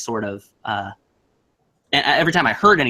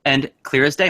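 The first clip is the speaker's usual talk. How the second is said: ordinary talk, nothing marked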